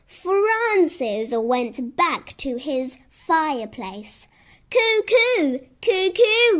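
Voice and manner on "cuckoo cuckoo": high teasing bird call; mocking cuckoo call. Francis teasing the trapped squirrel with a cuckoo call. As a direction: crow cuckoo back to mock him.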